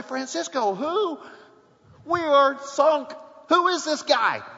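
high, slow, stunned Francisco who; mock despair at the pinch hitter; shocked disbelief. Lifelong Braves fan watching at home, stunned, slow, high disbelief. Fan's dismay retold at game.

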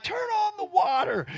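very high, strained mock cry; desperate call. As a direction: squeal it out to someone across the yard. Muddy, calling to wife at hose.

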